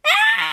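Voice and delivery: high-pitched excited scream; shrill little squeal; sudden high shriek. A narrator's reading. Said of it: "she screamed".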